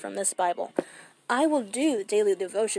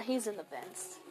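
ordinary talk

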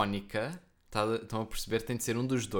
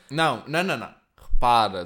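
loud protest; 11 dB louder than usual. Real, not acted. Objecting to being in the law.

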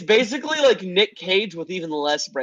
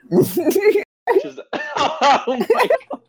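laughing; 14 semitones higher, 3 dB louder, much slower than usual. Real, not acted. Cracking up at joke.

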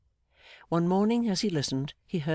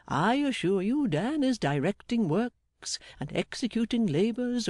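jolly Mister Meagles; 3 semitones higher than usual. Acted. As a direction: boast heartily about a dear friend. Voices Meagles extolling Dan.

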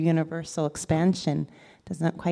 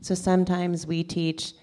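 ordinary delivery

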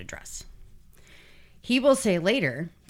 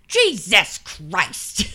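drawn-out, louder mock exclamation; exclaiming officers; 2 semitones higher, 5 dB louder, much slower than usual. SWAT driving down the street, seeing cop cars, mock drawn-out exclamation. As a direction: imitate officers crying out, each word stretched. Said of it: "you can hear them going".

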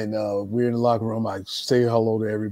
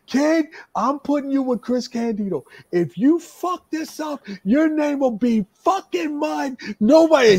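high, loud, forceful ordering; retold yelled command, hammering the swear words; going off; 4 dB louder than usual. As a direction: imitate a raging promoter threatening a rookie. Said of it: "he's going fucking off".

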